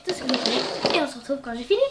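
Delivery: normal talking voice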